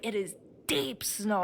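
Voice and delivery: ordinary delivery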